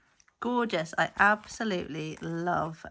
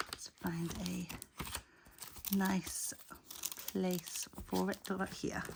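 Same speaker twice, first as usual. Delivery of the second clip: out of breath, half-whispering; winded and whispering from the stairs; breathless whisper; 6 dB quieter than usual. Real, not acted. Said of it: "I'm out of breath".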